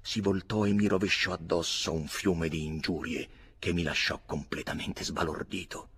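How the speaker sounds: ordinary delivery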